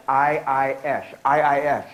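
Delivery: Aiyayesh slowly and clearly said, as eye-eye-yesh; word said slowly. Shown as a demonstration. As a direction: spell out Aiyayesh syllable by syllable for the class. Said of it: "Can you say Aiyayesh?".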